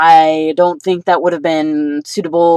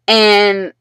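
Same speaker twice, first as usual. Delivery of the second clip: ordinary delivery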